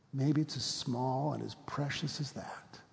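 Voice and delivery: slow, soft and tender; tender whisper. Real, not acted. Pastor pointing to a new baby, tenderly whispered. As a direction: hush it like a lullaby.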